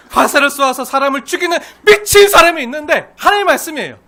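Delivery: loud, high, hammered phrases; emphatic preaching. Real, not acted. Preacher repeating the verse to congregation, loud and emphatic.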